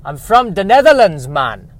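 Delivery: loud, swooping fake Jamaican accent, high then dropping; put-on Jamaican accent. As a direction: insist loudly in a bad Jamaican accent. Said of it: "trying to sound like someone who's from Jamaica".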